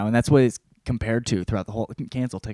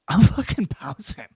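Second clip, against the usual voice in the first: laughing whisper; 7 semitones higher, 5 dB louder than usual. Retold or imitated. Kunta deciding to run.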